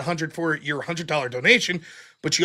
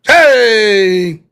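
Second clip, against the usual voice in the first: loud calling hey; 11 dB louder than usual. Real, not acted. Host opening the whip song round, a loud call. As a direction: call the room to attention.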